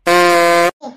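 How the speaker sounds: loud low bark of ey; sharp shout. Acted. Shouting back at the friend mocking him, sharp loud ey. Angry retort to teasing.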